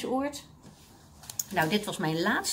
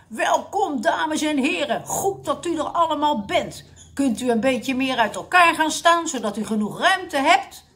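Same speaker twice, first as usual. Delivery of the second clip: loud, raised commanding address; megaphone loudness; 6 semitones higher, 5 dB louder than usual. A narrator's reading. Dictator addressing seniors through a megaphone, loud. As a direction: call out to the whole hall. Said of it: "Het klonk zo hard".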